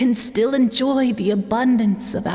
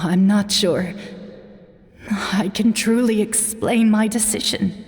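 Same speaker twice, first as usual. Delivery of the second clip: ordinary talk, nothing marked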